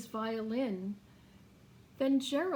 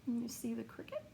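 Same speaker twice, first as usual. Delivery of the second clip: whisper; 7 dB quieter, much faster than usual. Real, not acted. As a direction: whisper the question softly. Reader aside to child.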